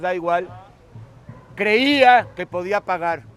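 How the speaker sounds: loud, emphatic preaching at full force; impassioned sermon climax; fervent preaching. Acted. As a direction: proclaim it, building to a shout.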